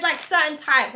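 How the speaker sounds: ordinary talk, nothing marked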